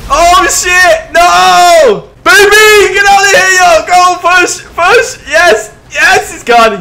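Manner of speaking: very high, loud frantic yelling; frantic shout. Real, not acted. Player pushing a rival off, frantic screaming. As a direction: scream it out in the heat of play. Frantic push, opponent falls off.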